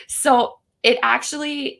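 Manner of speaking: ordinary talk, nothing marked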